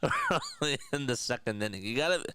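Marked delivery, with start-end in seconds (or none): 1.8-2.2 fast auctioneer patter. Shown as a demonstration. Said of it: "the auctioneer voice".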